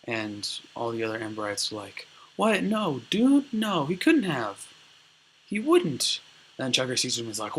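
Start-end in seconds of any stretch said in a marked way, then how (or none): 2.4-6.2 high, loud incredulous protest; mock shocked disbelief. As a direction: act out scandalized defence of a friend. Onlookers disbelieve the accusation.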